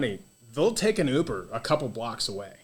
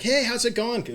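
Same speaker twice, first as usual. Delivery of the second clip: cheery greeting; 11 semitones higher than usual. Retold or imitated. Locals waving cheerfully.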